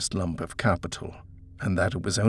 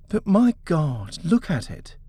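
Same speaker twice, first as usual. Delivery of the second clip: dismayed; 9 semitones higher than usual. Acted. Wife dismayed at the shabby house.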